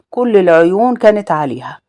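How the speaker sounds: plain reading voice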